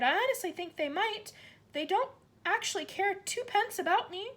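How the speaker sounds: put-on high hopeful girl; excited child. A narrator's reading. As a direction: rush it out hopefully. Matilda voiced.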